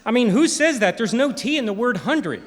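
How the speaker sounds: high, loud mock telling-off; exasperated scolding. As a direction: school him on his mistake, loudly. Acted scolding by the sound engineer.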